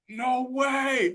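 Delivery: retold scream. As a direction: yelp it up high like a furious parent. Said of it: "I'm screaming".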